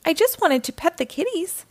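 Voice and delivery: mild plaintive cry. A narrator's reading. Frightened cat burglar excusing himself, mild plaintive cry. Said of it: "the cat burglar cried".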